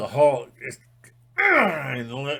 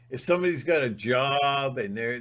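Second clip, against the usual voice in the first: ordinary talk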